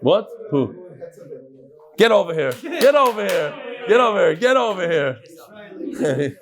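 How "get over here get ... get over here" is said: repeated mock-stern summons, jumping up in pitch; calling someone over. Teacher summoning a humming student amid laughter, mock shout. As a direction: holler it over and over, stretching the last one.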